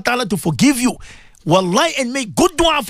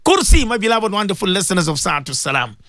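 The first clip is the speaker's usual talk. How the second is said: normal talking voice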